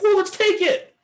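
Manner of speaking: hammed-up impatient trader; mock eager trader. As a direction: squeak it out like a jumpy newbie. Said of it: "would you be like".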